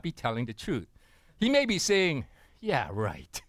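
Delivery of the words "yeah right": skeptical dismissal. Acted. God doubting the claim.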